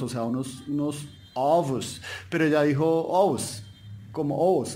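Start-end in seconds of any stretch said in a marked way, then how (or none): 1.3-2.0 ovos with a clear V; drawn-out ovos, Portuguese V. Shown as a demonstration. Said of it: "era porque tocaba decir ovos". 2.8-4.7 ovos with the V softened away; ovos, consonant barely touched; Colombian-Spanish ovos. Shown as a demonstration. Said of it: "como diríamos en español colombiano".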